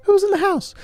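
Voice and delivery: very high squeaky chant; shrill falsetto sung line; falsetto squeal. Shown as a demonstration. High falsetto take of lyric.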